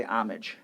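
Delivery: "homage" with a silent H; homage without the H. Shown as a demonstration. Co-host telling how she says it, H dropped. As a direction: start "homage" straight on the vowel. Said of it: "I say without the H".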